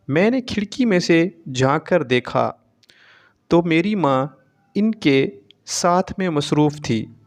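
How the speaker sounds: ordinary delivery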